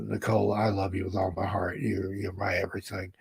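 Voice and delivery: soft, tender declaration of love. Real, not acted. Husband telling his wife he loves her, soft and tender. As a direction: speak gently to her.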